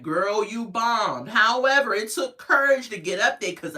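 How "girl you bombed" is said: put-on slow, deliberate girl you bombed; blunt verdict. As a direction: drag it out to drive the point home. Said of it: "'cause I done like".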